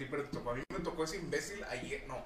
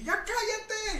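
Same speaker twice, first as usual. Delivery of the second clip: shouted; much slower than usual. Retold or imitated. Lady caller screaming at the agent, high shout. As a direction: scream it, drawn out. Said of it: "Y gritó así".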